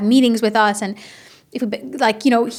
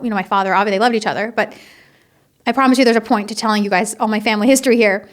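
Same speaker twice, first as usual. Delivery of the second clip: ordinary talk, nothing marked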